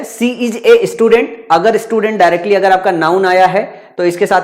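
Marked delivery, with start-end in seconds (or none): 0.0-1.3 "She is a student" read out word by word, very slowly; slow example reading. Shown as a demonstration. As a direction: dictate the example slowly, one word at a time. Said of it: "जैसे यहां पे sequence है". (धीरे-धीरे, शब्द-शब्द करके उदाहरण पढ़ें)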